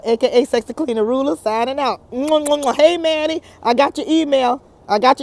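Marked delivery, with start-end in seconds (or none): 2.1-2.7 quick soft kiss noises; soft mwah kiss sounds; blown kisses. Real, not acted. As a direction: blow smacking kisses to the audience. Blowing kisses at sign-off.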